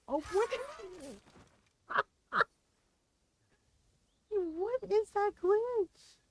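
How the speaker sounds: real surprise at the game glitch; startled by glitch. Real, not acted. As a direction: yelp at the broken game.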